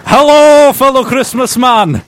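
high, loud, hammy greeting; jolly character voice. Acted. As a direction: belt it out like a panto character. Acting Christmas Man two role.

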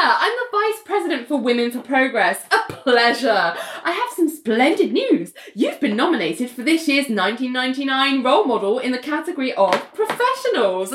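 high, gushing vice-president act; hammed-up formal introduction, swooping high on the pleasantries; Laura Dipson. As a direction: announce splendid news with put-on grandeur. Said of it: "so she's like".

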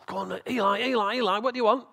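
high, loud, urgent call; eager boy calling. Acted. Young Samuel running to Eli at night, eager calling. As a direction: play an excited lad waking someone.

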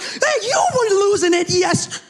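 high-pitched comic comeback; hammed-up bickering spouse; mock indignant retort. Acts out retort in parenting quarrel.

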